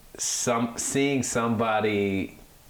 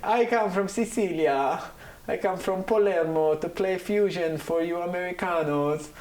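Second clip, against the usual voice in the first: much higher, exaggerated Italian accent; mock Italian accent; 10 semitones higher than usual. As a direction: imitate the bassist's Italian English. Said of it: "he was like".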